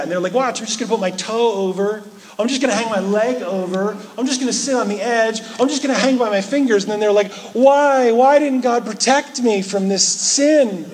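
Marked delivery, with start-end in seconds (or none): 0.3-6.8 higher, fast, cocky taunting; reckless daredevil. Retold or imitated. Someone teasing danger at the cliff edge, high mock bravado. 7.5-10.9 much higher, louder aggrieved whine; whiny complaint. Retold or imitated. The fallen sinner blaming God, high indignant whine.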